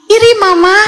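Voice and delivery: put-on high-pitched little child voice; child answering Mama. Acted child answering mother. (suara anak kecil menjawab mama)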